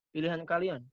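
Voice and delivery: ordinary talk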